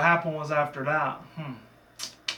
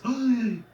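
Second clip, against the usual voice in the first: high, loud-ish theatrical gasp; famous mock gasp; 10 semitones higher, 4 dB louder than usual. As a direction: gasp in exaggerated shock. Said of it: "gasping type of thing".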